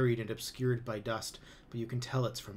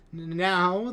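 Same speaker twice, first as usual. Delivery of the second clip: higher, louder nervous stammer; 8 semitones higher, 11 dB louder than usual. A narrator's reading. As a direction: trip over the first word. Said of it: "they stammer".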